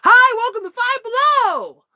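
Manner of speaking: high, loud store greeting; shouted greeting. Retold or imitated. Coworker greets customers from the back of the store at full shout. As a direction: yell it across the store. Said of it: "top of his lungs".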